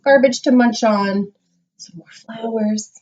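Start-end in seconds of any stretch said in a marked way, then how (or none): 1.8-2.9 sneaky whispered aside; hushed mischievous aside; whispering. Acted. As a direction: whisper it like a secret.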